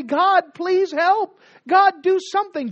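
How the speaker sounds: loud, high cry for help; desperate plea. Acted. People at the end of hope crying out loud to God.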